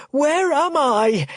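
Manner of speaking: slow, high, loud pleading cry; frightened plea. Acted. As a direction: beg to know where he is. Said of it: "he pleads again".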